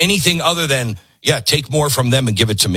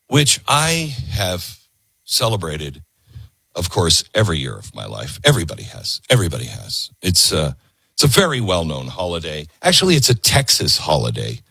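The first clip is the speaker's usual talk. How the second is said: deadpan sarcasm; 8 semitones lower than usual. Acted. Host sneering low about Juneteenth being well known. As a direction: drop low and dry, mocking the claim.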